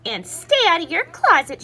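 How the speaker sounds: a parting order from the doorway; stern mother. A narrator's reading. Mother warns Skippy leaving room, stern order. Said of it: "she added".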